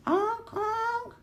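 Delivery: high, squeaky honk honk; playful high honking horns; car horn honks. A narrator's reading. Car horns in read-aloud story.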